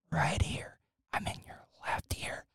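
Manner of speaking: soft whisper like ASMR, right up in the ear. Shown as a demonstration. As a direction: breathe the words close to the mic. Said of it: "I will record you in stereo".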